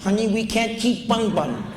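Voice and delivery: high mock mother's voice; crazy woman's voice. Crazy woman answers kids and begs clerk, high mock voice. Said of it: "this woman talked like this".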